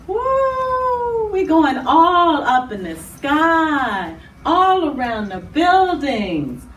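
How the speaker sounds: drawn-out whoop, then slow stretched words; playful soaring wonder. Pretend. Host pretending to fly over buildings with children, drawn-out excited wonder.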